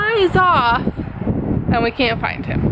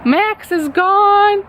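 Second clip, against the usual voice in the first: retold bawling; 8 semitones higher, slower than usual. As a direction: draw it out like you're bawling. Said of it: "bawling my eyes out".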